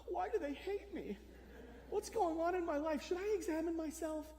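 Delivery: mock the overthinker spiralling at home; anxious self-doubt. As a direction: person stung by a stranger's insult, mock fretting. Imagined anxious self-questioning.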